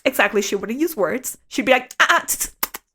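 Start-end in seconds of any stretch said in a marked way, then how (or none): 2.0-2.8 high scolding ah-ah and tongue clicks; disapproving tsk sounds. Retold or imitated. Roommate scolding her with clicks and ah-ahs. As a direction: mimic a scold with only clicks. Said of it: "She would also treat me with just sounds".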